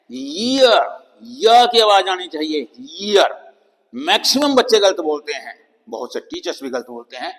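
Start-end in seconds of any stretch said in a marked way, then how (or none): 0.1-3.5 ईयर with a clear y sound, yeer; correct pronunciation. Shown as a demonstration. Teacher correcting students' common mistake, a clear yeer. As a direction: put a y sound before ईयर. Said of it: "इसको बोलना है".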